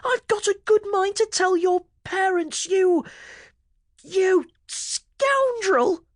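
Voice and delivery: put-on very high, slow, indignant boy's voice; cross yell. A narrator's reading. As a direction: tell him off, outraged. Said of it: "how cross he was".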